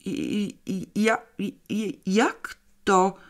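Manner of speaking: high, strained stuttering; halting stammer, dragging the last words; effortful stammer. A narrator's reading. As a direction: struggle to get the words out. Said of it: "powiedział z wysiłkiem".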